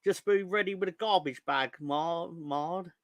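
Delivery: reading out in a mock Flanders accent. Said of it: "I did Flanders accent".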